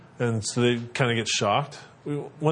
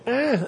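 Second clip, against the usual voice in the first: put-on a high little baby eh; imitated baby sound; 13 semitones higher than usual. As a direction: coo like a newborn. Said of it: "making baby sounds".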